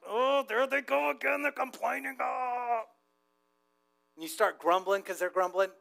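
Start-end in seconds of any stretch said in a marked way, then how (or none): 0.1-2.8 high-pitched whiny groan about complainers; exasperated mock moan. Preacher acts out being around complainers, high mock whine. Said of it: "We are around complainers sometimes like".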